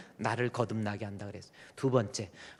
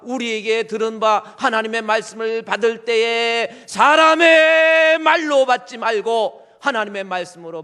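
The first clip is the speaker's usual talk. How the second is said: high, loud proclaiming of scripture; preacher reading the verse at full force; fiery preaching; 10 semitones higher, 13 dB louder than usual. Acted. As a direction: thunder the verse at the congregation.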